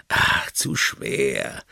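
tief, langsam, gemurrt; mürrisch murren. Acted. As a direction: brummt missmutig. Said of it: "murrt Oliver". (Oliver hat keine Lust zu schleppen, murrend)

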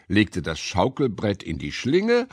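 plain reading voice

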